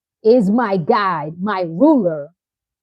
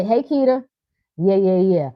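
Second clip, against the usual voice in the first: ordinary delivery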